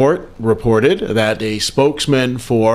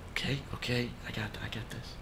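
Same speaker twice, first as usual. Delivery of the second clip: muttering to self; 22 dB quieter, faster than usual. Real, not acted. Muttered self-encouragement between takes.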